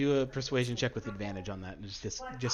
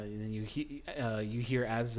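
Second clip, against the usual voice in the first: usual speaking voice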